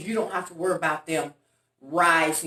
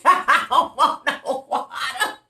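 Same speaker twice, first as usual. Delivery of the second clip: high, slow words through laughter; laughing hard; 7 semitones higher, 3 dB louder, slower than usual. Real, not acted. Retelling Uncle refusing water for vodka, helpless laughter. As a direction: crack up while quoting him.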